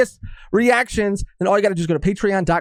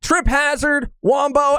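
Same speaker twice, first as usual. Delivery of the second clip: normal talking voice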